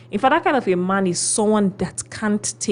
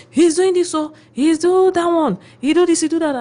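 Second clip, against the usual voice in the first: high, loud whiny complaint; mimicking complaining wives; 9 semitones higher, 6 dB louder than usual. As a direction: whine it like a fed-up wife. Women complaining about husbands.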